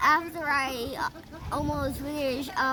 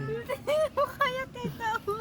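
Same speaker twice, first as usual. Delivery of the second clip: high squeal; 10 semitones higher than usual. Real, not acted. Child squeals back at mother.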